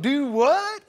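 high, loud puzzled "Do what?"; baffled rural clerk. Retold or imitated. County clerk to the caller, loudly puzzled. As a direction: act like the question makes no sense.

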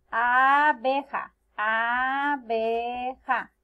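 abeja drawn out as aa - bee - ja; slow, lengthened abeja; stretched syllables. Shown as a demonstration. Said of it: "Tiene tres sílabas".

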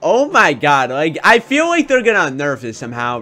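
ordinary talk

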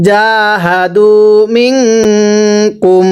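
slow chant with stretched vowels and nasal hum; Quran recitation. Shown as a demonstration. Teacher recites for students to repeat, slow recitation. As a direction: stretch জাহাদু, hum long on মিং.

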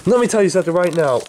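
furious. Acted. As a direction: lay into him in rage. Angry threat.

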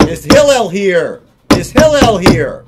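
loud call through a closed door. Acted. Man at the door calls for Hillel, loud and insistent.

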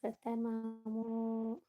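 very slow, stretched-out name call; drawn-out calling. Real, not acted. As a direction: call out, holding the vowels long. (നീട്ടി വിളിച്ച്)

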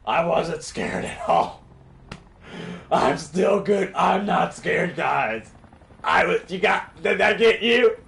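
loud, high, defensive protesting; flustered denial. Real, not acted. Player denying to viewers he was scared, flustered and loud. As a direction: insist hard, rattled from the scare. Defensive after being startled.

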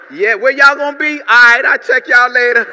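loud, casual brush-off; breezy loud goodbye; Thomas bowing out. Acted. Thomas loudly tells the disciples he'll catch them later. Thomas voiced slipping away.

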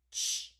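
"ch" as a loud, quick burst; short ch sound. Shown as a demonstration. Teacher naming C H, short "ch". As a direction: release "ch" sharply with no vowel. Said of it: "I hear a short air coming out".

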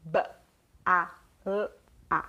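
B, A, L, A as four separate sounds with long gaps. Shown as a demonstration. Teacher showing parents the four sounds of bala, sound by sound. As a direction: sound out B... A... L... A one at a time. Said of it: "eu tenho quatro sons".

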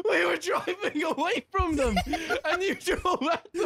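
high, incredulous laughing protest; laughing complaint. Real, not acted. Berating teammate while laughing.

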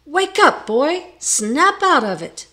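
high, loud shout. A narrator's reading. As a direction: shout in his ear to wake him. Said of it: "he shouted in his ear".

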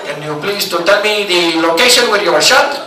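put-on unhurried operator script in Filipino accent; over-polite agent, put-on Filipino accent. Operator repeats caller's emergency back, Filipino accent. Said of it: "outsource nine one one to the Philippines".

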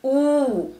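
long, held "oo"; isolated oo sound. Shown as a demonstration. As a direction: draw out "oo" as a lone vowel. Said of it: "give the oo sound".

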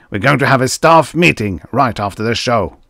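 crying out. A narrator's reading. Angry producer orders a meeting, crying out. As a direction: call it out forcefully. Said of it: "he cried".

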